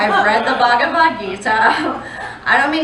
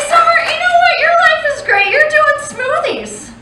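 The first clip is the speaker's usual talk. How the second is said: higher, bubbly mock-sincere praise; lightly voiced gushing friend; 14 semitones higher than usual. Friend Anna praising Summer, lightly voiced gushing. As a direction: gush like a supportive friend. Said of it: "She said to me".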